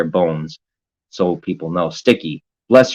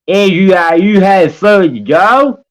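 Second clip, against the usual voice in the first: lightly voiced, higher, louder and drawn out; mock chat voice; 11 semitones higher, 5 dB louder, slower than usual. Streamer reading Glenn's chat line in a mock high voice. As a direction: tease the chatter with a silly high voice.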